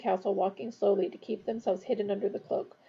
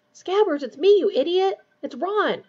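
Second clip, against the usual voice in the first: higher, louder scolding of a pet rat; exasperated hiss; 8 semitones higher, 6 dB louder than usual. A narrator's reading. Ron scolds his struggling rat, in an exasperated hiss. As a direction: read it as an annoyed, urgent telling-off. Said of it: "Ron hissed".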